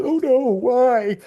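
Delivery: high, slow hammed-up wail of dismay; mock dismay. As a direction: overdo the shocked despair. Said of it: "have the".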